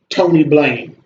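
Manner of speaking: ordinary talk, nothing marked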